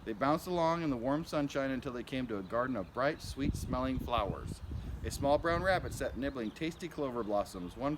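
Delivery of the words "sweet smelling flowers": ordinary delivery, nothing marked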